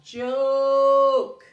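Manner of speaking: Joke, loud, with a long O. Shown as a demonstration. Teacher modelling the long O loudly for kids. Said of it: "That silent E makes that O long".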